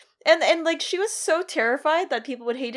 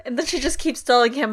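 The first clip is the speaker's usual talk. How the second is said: usual speaking voice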